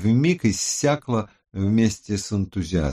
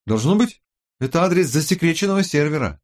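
higher, livelier character voice; team member; 8 semitones higher, 3 dB louder, faster than usual. A narrator's reading. Investigator explains the secret server to the team, animated. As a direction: voice an investigator putting a theory forward.

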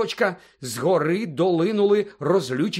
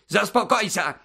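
higher, raised-voice bark; barked order; 6 semitones higher, 4 dB louder than usual. A narrator's reading. Fudge ordering Snape to calm down, barked. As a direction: bark it to shut him up. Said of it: "гаркнув Фадж".

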